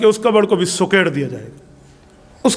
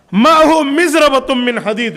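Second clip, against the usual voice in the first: forceful hadith recitation; 5 semitones higher, 3 dB louder than usual. Acted.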